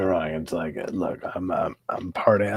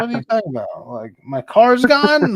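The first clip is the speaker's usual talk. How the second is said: mock disbelief; 14 semitones higher, 7 dB louder than usual. Retelling learning his car was gone, high and disbelieving. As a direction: act floored by the news, pitched up.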